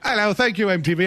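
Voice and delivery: high, posh-ish mock English accent; host mimicking the actress's English accent at the awards. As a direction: imitate the actress thanking MTV in an English accent. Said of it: "all of a sudden she had an English accent".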